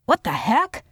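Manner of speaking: high, startled outburst; mild yell. A narrator's reading. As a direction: yell it indignantly without much volume. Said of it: "he yelled".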